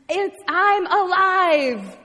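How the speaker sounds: loud, high, drawn-out exclamation; triumphant announcement. Acted. Jesus greeting his friends with a high five, exultant. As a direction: proclaim it big, stretching the words.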